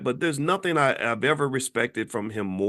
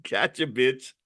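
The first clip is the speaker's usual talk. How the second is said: laughing gloat; slower than usual. Real, not acted. Gloating over the slip.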